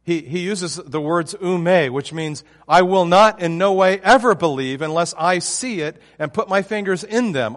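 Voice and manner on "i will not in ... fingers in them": loud, insistent 'I will not in no way ever believe'; emphatic refusal. Retold or imitated. Preacher voicing Thomas's flat refusal, loud and emphatic. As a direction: hammer home the refusal, louder. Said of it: "It's emphatic".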